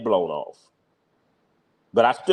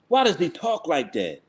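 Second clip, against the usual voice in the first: normal talking voice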